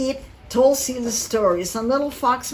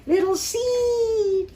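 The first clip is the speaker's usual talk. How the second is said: high drawn-out cry; calling out; 11 semitones higher, much slower than usual. A narrator's reading. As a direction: call the name high and long, worried. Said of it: "he shouted".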